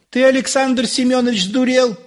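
high-pitched indignant wife; scolding wife. A narrator's reading. Wife retorts to fussing husband, high and indignant.

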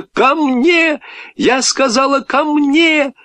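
put-on high girl's plea posing as an order; plaintive attempted command. A narrator's reading. Scared girl failing to order the dog, plaintive. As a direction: insist in a high, pleading voice. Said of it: "жалобная просьба".

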